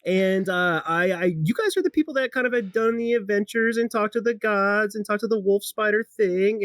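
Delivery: high-pitched, effusive gnome greeting; bubbly gnome. Acted. Gnome runs up to greet adventurers she recognizes, bubbly and high. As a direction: gush in a high, excitable voice. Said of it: "very bubbly gnome runs up to him".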